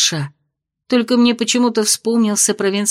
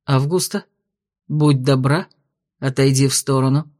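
low, cold and controlled; icy calm; 7 semitones lower than usual. A narrator's reading. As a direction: freeze her with calm. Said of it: "а тон ледяным".

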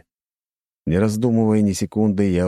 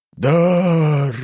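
loud, high booming statue cry; hollow statue voice; 11 semitones higher, 6 dB louder than usual. Acted. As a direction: boom it out like a living stone idol. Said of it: "сказала глухим голосом статуя".

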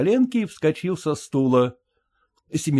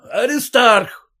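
higher, slow loud call; 9 semitones higher, 5 dB louder, slower than usual. A narrator's reading. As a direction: call him again, louder. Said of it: "стал он звать сына". (отец снова зовёт сына)

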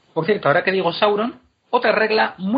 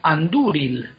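"Andúril" stressed on DÚ with a long u; much slower than usual. Shown as a demonstration. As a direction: say "Andúril" as an-DUU-ril. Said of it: "se queda con el acento".

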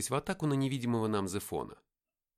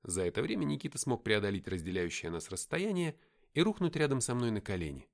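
usual speaking voice

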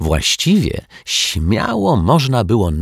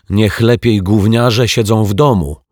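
loud, higher-pitched, resentful mimicry; aggrieved, raised voice; bitter quoting; 4 semitones higher, 6 dB louder than usual. A narrator's reading. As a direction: throw the quote out indignantly. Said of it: "zacytował rozgoryczony Dominik".

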